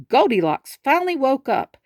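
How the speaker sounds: ordinary delivery, nothing marked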